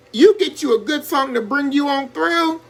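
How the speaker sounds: ordinary delivery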